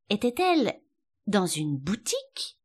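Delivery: usual speaking voice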